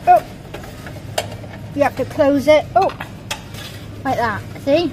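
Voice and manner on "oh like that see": ordinary talk, nothing marked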